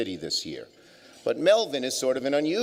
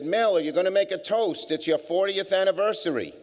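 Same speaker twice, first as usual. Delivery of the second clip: higher voice, coaxing Mel to stand and toast; guests urging; 9 semitones higher than usual. Retold or imitated. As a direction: pitch it up and nudge him like the table of guests.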